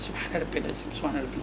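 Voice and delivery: barely voiced whisper of the prayer words; whispered tasbih. Shown as a demonstration. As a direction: breathe the words under your breath. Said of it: "دوسری رکعت میں".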